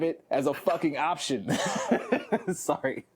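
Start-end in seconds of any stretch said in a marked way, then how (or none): none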